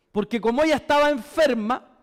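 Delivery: ordinary talk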